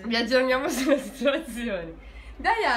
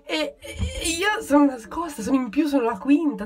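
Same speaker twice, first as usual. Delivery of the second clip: laughing; 5 semitones higher, 5 dB louder than usual. Real, not acted. Hiding, laughing at situation.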